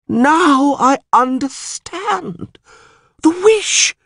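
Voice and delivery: high, loud dawning cry; the priest suddenly understands, crying out; excited realization. Acted. Priest to the mayor, crying out in sudden realization.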